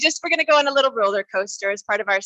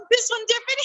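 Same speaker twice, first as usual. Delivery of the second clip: very high giggly laughter; another laughing breath; much faster than usual. Shown as a demonstration. Leader shows the group another laughing breath, high giggle. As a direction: squeal out a long high titter. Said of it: "another laughing breath".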